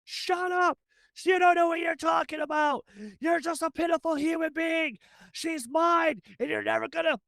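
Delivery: high-pitched acted tirade; screaming. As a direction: rant at the top of your register. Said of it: "Johnny just starts screaming".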